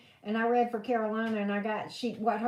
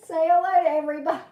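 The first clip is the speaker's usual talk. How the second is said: laughing, fast and louder; 6 semitones higher, 8 dB louder, much faster than usual. Real, not acted. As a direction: say it through a laugh.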